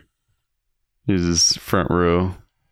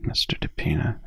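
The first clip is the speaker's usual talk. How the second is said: whisper; 3 semitones lower, 7 dB quieter than usual. Real, not acted. Reverent repeat of teacher's name.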